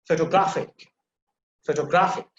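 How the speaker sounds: photographic with "GRAPH" punched; stress on third syllable. Shown as a demonstration. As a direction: lean firmly on "graph" in photographic. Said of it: "the stress is on the third syllable".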